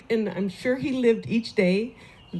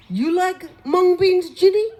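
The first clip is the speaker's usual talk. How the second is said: much higher, loud, slow hail; Lenny's call; 10 semitones higher, 7 dB louder than usual. Retold or imitated. Lenny teasing the neighbour from his car. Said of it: "He says".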